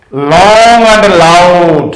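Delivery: "long and loud" drawn out slowly with weight. Shown as a demonstration. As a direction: stretch out "long and loud" to show stress. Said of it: "it is known as stress syllable".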